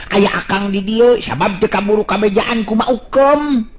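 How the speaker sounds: usual speaking voice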